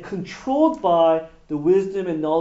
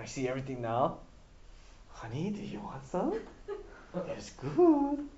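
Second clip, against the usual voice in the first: voicing Eve; 3 semitones lower, 11 dB quieter than usual. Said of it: "Eve eats".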